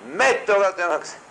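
high, loud mock exclamation of the phrase; imitated congregational cry. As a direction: belt it out high, imitating worshippers. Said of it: "some of them say".

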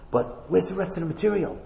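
quiet, confiding question to her husband; hushed queen. Acted. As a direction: lean in and drop the voice, planting doubt. Queen whispers suspicion to king.

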